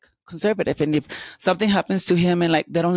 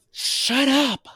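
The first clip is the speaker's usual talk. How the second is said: imitating him; 9 semitones higher than usual. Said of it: "He goes like".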